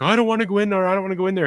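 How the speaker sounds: a hint of a frightened child; scared kids. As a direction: whine it like a spooked kid. Kids scared of goblin doors.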